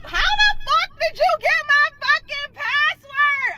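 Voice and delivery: high angry confrontation; furious demand. Retold or imitated. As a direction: snap at him in outrage. Confronts him on the phone.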